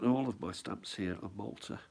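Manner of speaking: normal talking voice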